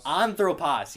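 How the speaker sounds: ANthropos, weight on the "an"; stress on first syllable. Shown as a demonstration. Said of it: "that acute is on that first syllable".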